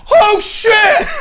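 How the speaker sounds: high, loud panicked yelp; panicked shout. Retold or imitated. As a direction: yelp it like a terrified Batman. Batman panicking in hell.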